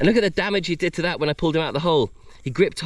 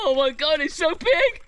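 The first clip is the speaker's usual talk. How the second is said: very high, thrilled exclamation; amazed squeal; 12 semitones higher than usual. Real, not acted. Seeing how big the crab is, amazed squeal.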